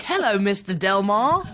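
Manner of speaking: put-on line read in his own British accent; slow British-accented greeting, laughing; British accent, not American. Laughing while quoting his own slip, British accent. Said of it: "forgot to do an American accent".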